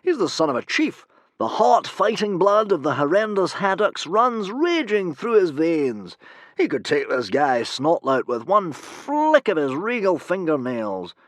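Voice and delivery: loud, high, slow booming praise; grand heroic Humongous. Acted. Humongous praising Hiccup to Fishlegs, grand and booming. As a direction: extol the hero's blood.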